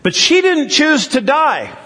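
high, plain-level protesting question; retold plea, pitched well up; questioner's protest. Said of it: "the question came back".

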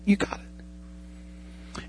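a slow, drawn-out whisper of encouragement. Pretend. Trainer easing the student pilot: slow whispered reassurance. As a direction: fade right down to a breath, very slowly. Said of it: "he'll come alongside you and it's like".

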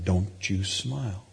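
slow, low mock warning; mock-stern command. Dad quoting himself to his son, slow mock-stern. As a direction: drawl it as a playful order.

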